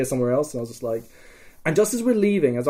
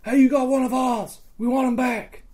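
retold called demand; 11 semitones higher than usual. Player demands captive from pickets, high hailing. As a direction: shout terms across a distance.

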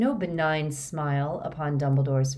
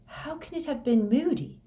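high-pitched, incredulous question; complete disbelief; 9 semitones higher than usual. A narrator's reading. Harry learning Moody betrayed him, in complete disbelief. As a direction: ask in stunned disbelief, pitched well up. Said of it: "in a state of complete disbelief".

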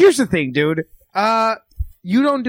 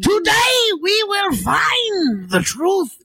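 high, very slow grandiose proclamation; Raul Julia; 10 semitones higher, much slower than usual. Acted. Comic proving his solid-nine impression, grandiose Raul Julia voice. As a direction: proclaim it theatrically, stretching the vowels. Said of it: "I have a solid nine".

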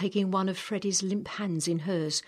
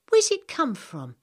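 put-on higher, eager girl's question; a curious young girl asks, lifted and bright; 9 semitones higher, 6 dB louder than usual. Samantha voiced by narrator.

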